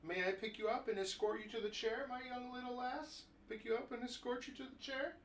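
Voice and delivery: high, soft, courtly mock gentleman; bow and offer your arm to the little lady; mock gallant suitor. Owner offering the dog his arm like a gentleman.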